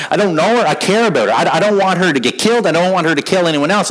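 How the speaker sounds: normal talking voice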